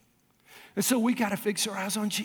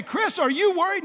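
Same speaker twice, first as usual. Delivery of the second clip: wife's voice lightly voiced higher; imitating wife; 9 semitones higher than usual. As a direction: play his wife asking gently. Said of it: "Michelle would say".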